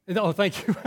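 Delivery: laughing. Real, not acted. Thanking a helper, laughing.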